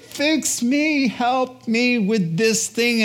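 high, slow plea for help; pleading. Retold or imitated. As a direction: beg for help, voice lifted and slowed. Pleading voice of seeker.

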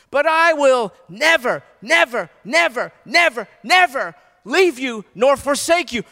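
God's promise, hammered home with force; loud voicing of God's promise. Preacher voicing God to congregation, loud and emphatic. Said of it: "You know what God is saying?".

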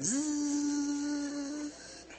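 long high-pitched electric whirr; a steady, high "zzz" motor drone; motorized chair buzz. Retold or imitated. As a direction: imitate a mobility chair humming along. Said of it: "those chairs they drive around in".

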